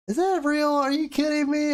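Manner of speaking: high, loud imitation of a disbelieving wife; mock amazed wife. Husband imitating his wife's amazement at the profit, high and loud. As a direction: play his wife who can't believe it. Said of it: "She was like".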